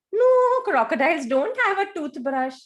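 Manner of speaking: loud playful no; emphatic no. Real, not acted. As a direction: shut the idea down with a big no. Emphatic denial to children.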